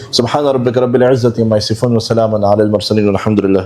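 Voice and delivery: rapid, low recited Arabic closing; closing prayer formula. Real, not acted. Preacher closing the sermon with a quick recited prayer formula. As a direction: rattle off the familiar closing blessing.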